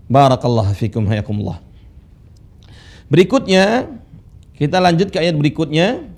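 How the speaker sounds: usual speaking voice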